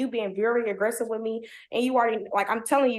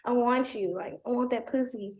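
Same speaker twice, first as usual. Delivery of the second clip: softer mock drunken begging; lightly imitated drunk boyfriend; drunk pleading; 6 dB quieter than usual. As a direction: slur it like one shot too many. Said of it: "it's like he's drunk".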